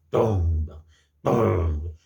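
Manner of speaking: loud, low booming "tom"; drumbeat imitation. Shown as a demonstration. Teacher showing children a drum's force, booming drum imitation. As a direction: boom it like a big drum being struck. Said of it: "Quando você bate um tambor também".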